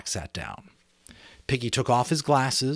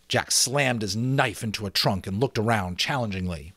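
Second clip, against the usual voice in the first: plain reading voice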